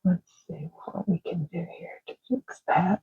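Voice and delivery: soft unvoiced whisper while coloring; hushed Bob Ross style whisper. Real, not acted. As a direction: whisper it to yourself, absorbed. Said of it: "I don't know why I'm whispering".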